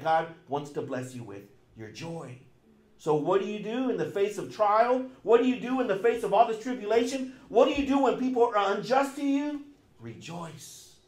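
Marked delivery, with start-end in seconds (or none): 3.0-9.6 rising, louder rhetorical questions; rising preaching. Real, not acted. Preacher pressing the congregation, rising and louder. 10.0-10.9 soft answer. Real, not acted. Preacher answering his own questions, soft and low.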